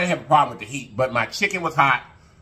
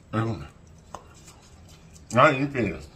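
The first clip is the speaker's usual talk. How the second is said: ordinary delivery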